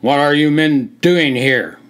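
loud, stern officer's challenge; officer confronting the men, loud and commanding; commanding demand. A narrator's reading. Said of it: "demanded a loud, commanding voice".

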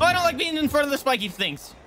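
high-pitched gamer panic; panicky yelling. Real, not acted. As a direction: cry out while dodging danger. Panicking in front of boss's antlers.

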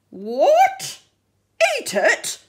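loud, outraged splutter. Acted. Shark scolding the octopus for trying to eat art, spluttering. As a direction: sputter at the very idea. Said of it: "Shark spluttered".

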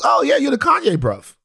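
a mock British greeting at a party; imitating Daniel's British accent. Daniel greeting him at a party, a light British accent. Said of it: "And Daniel goes".

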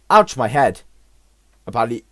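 ordinary talk

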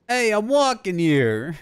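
put-on Boston accent, slow and loud; hammed-up Boston street yell. Player showing off his only Boston accent. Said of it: "The only fucking Boston I can do is".

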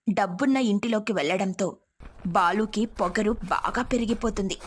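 usual speaking voice

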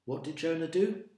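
ordinary delivery

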